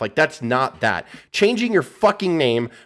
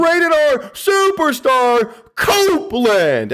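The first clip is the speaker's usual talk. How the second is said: slow, high booming ring announcer voice; announcer impression; 11 semitones higher, 4 dB louder, much slower than usual. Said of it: "he was like".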